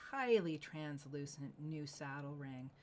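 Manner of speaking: normal talking voice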